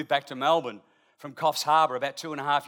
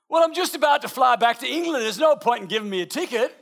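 a hint of a broad Yorkshire accent, pitched higher; lightly voiced Yorkshire woman; 7 semitones higher, 5 dB louder than usual. Wife sweet-talking the policeman in Yorkshire accent. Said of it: "in her broadest Yorkshire accent".

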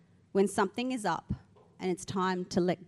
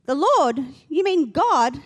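loud, raised incredulous questions; acted astonishment; 5 semitones higher, 8 dB louder, slower than usual. Dangling man learns the voice is God, loud astonishment. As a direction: question it loudly, pitch lifted.

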